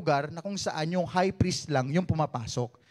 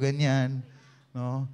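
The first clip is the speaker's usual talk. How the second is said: normal talking voice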